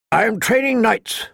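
high, pointed, knowing reply. Acted. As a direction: make the jab land. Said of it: "Sir Roderick said pointedly".

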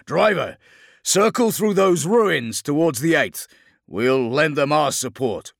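loud, high, forceful command; shouted battle orders. Acted. Captain redirecting tank driver, shouted battle orders. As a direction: project directions to the driver over engine noise.

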